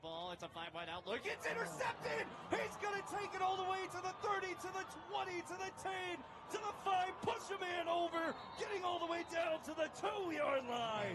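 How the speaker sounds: high, shouted touchdown call, racing on the return; play-by-play call. Acted. Host calling a pick return for viewers, shouted play-by-play. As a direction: yell it like the crowd is on its feet.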